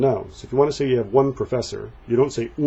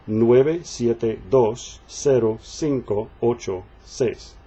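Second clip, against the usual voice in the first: slow drill reading; slower than usual. Shown as a demonstration. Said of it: "Let's read them through".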